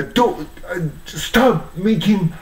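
re-enacted yell, high and halting. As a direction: cry out at an imagined enemy. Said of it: "I yell".

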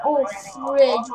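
normal talking voice

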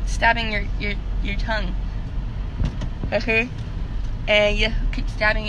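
talking with lip pulled down; finger pulling lip. Real, not acted. Seen 1: Finger pulls lower lip down, exposing braces, during the labelled words.

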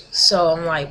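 ordinary talk, nothing marked

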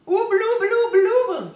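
high, loud, earnest gummed-up mumbling; earnest toffee mumble. A narrator's reading. Moonface earnestly telling the children, mouth full of toffee. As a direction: plead earnestly through stuck teeth. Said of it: "he said very earnestly".

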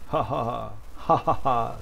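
evil villain cackle; villain laugh. Shown as a demonstration. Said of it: "like, a, a villain's voice".

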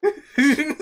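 high, delighted squeal through laughter; laughing excitedly. Real, not acted. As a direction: burst out laughing in disbelief. Laughs at zombie eating pumpkin.

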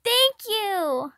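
put-on high, loud, delighted child's cry; a happy kid squealing his thanks; near-scream of joy. A narrator's reading. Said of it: "nearly screaming".

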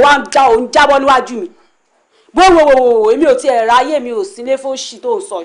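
usual speaking voice

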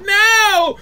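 high, loud mock shriek; mock scream. Wife seeing the tentacle take him, mock scream. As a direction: shriek in fake terror. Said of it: "she's screaming".

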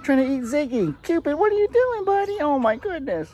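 high, sing-song voice scolding a goat; pet talk. Real, not acted. Scolding goat Cupid fondly.

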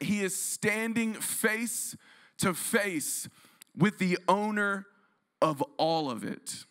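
slow, lifted preacher emphasis; dramatic emphasis. Acted. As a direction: land the reveal slowly. Echoing the dramatic build.

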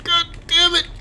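high, loud outburst of annoyance; exasperated, high-pitched yelling; frustrated shouting. Real, not acted. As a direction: yell furiously after the one getting away. Run over, yelling after driver.

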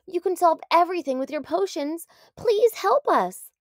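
pleading lizard. A narrator's reading. Lizard begging the doctor to help, high. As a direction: plead with everything.

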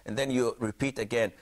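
ordinary talk, nothing marked

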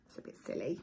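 ordinary delivery

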